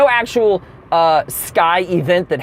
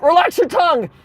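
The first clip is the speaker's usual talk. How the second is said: high-pitched mock emergency command; urgent doctor; 10 semitones higher than usual. Doctor rushes over to seizure patient, high acted urgency. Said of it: "He's like".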